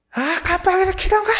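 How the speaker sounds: high, loud furious shout at another driver; road-rage yell out the car window; angry yell. Retold or imitated. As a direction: bark it out like an enraged motorist. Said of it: "소리를 버럭 지르면서".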